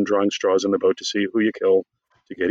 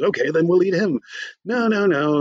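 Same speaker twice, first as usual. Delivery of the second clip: high-pitched mock squabble; arguing sketch characters; 7 semitones higher than usual. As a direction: bicker lightly in a raised comic pitch. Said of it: "they're arguing over who they're gonna eat".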